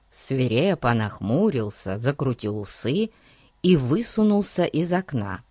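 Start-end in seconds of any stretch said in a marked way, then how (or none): none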